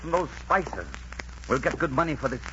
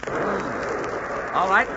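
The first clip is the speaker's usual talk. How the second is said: usual speaking voice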